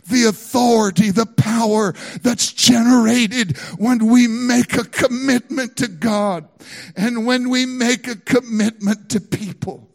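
loud, slow, ringing sermon delivery; full-force preaching. Acted. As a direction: preach it out to the whole congregation.

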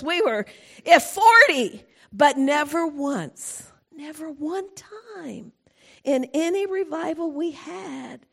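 ordinary talk, nothing marked

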